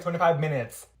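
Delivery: ordinary talk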